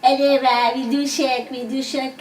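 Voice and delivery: childish lisping mockery; gleeful baby-talk taunt; little brother teasing. A narrator's reading. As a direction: jeer like a small boy, starting loud. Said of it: "कहते हुए मेरा छोटा भाई जीतू".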